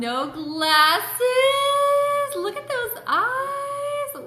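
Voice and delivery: high squealing gush over her son. Real, not acted.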